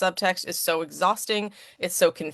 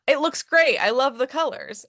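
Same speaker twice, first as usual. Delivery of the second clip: gushing praise; 7 semitones higher than usual. Retold or imitated. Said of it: "an allistic person might answer".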